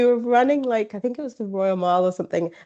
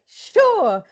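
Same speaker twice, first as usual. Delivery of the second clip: high, louder cheerful sure; high, louder, chipper yes; chirpy friend; 12 semitones higher, 6 dB louder than usual. Retold or imitated. Friend agreeing, voiced high.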